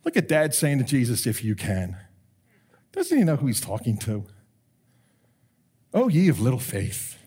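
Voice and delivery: a hint of a smug scoffing churchgoer; self-righteous mockery. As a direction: sneer at Dad like a smug know-it-all. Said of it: "a lot of us self-righteous people say".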